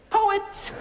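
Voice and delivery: feigned shock at the word; mock disbelief. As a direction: say it like it's absurd.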